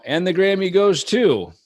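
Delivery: high, loud mock presenter reading the winner; award presenter. Presenter at the Grammys announcing the loser, hammed-up high announcer. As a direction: play it like the envelope is opened. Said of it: "they call".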